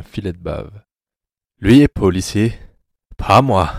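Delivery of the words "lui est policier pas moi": louder, lilting sinister smile; unhealthy smile in voice. A narrator's reading. Anatole menaces the helpless commissioner, a sick smile in his voice. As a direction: say it with sick, smiling relish. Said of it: "un sourire malsain dans la voix".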